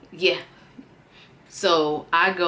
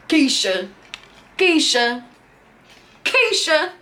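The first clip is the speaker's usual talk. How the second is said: mock calling name; 9 semitones higher, 4 dB louder than usual. Name carved in window.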